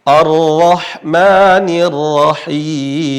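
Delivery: Ar-Rahmanir Rahim slowly drawn out, ro thick toward O; measured tajwid chant; slow Quranic recitation. Shown as a demonstration. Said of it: "Ini ro berharokat fathah".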